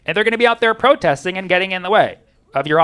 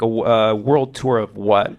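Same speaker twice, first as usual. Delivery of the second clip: low, slow, hesitant cop voice; puzzled officer; 7 semitones lower than usual. Retold or imitated. Officer questioning a celebrity about his tour, slowly baffled. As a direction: drag out the question in a deep, baffled imitation.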